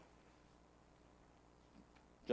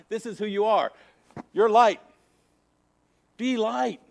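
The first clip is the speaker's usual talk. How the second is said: emphatic exhortation; 9 semitones higher, 9 dB louder than usual. Real, not acted. Preacher exhorts the congregation.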